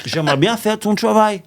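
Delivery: high-pitched, hammed-up American accent in French; exaggerated American-accented French; mock American accent. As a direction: play the foreigner with a thick fake American accent. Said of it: "non, c'est un accent américain".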